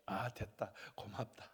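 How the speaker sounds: low, soft fatherly reply; contented acceptance. Retold or imitated. The Lord accepting thanks as enough, low soft voice. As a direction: murmur it low and satisfied.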